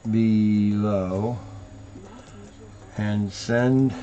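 soft, slow reading aloud to himself; slow muttered reading. Real, not acted. Host reading a car form to himself, soft muttering. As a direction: mumble through the form word by word.

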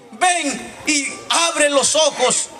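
high, loud pleading cry to God; impassioned shouted prayer. Real, not acted. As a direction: pray at full force, begging for your sons. Prayer at full force.

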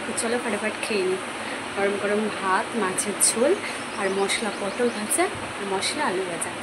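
soft, subdued voice beside a sleeper; speaking softly. Real, not acted. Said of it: "আস্তে আস্তে কথা বলছি যাতে ও ডিস্টার্ব না হয়".

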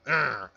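short, loud mock grunt of pain; acted pain cry. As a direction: let out a pretend "argh". Repeats the imagined pain reaction.

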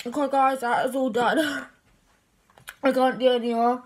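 talking through a full mouth; drooling round a mouthful. Real, not acted. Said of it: "I'm dribbling".

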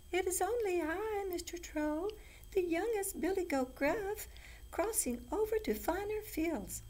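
very high-pitched little goat; tiny goat voice. A narrator's reading. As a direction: squeak it like the smallest billy goat. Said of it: "said the little goat in a tiny voice".

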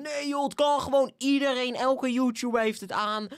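chorus of viewers. Acted. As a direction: imitate everyone objecting at once. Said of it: "als echt iedereen zegt".

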